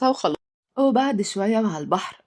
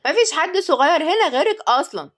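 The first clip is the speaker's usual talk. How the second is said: higher, mildly angry comeback; annoyed retort; 7 semitones higher than usual. A narrator's reading. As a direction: snap back with irritation. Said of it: "واتعصب إياد".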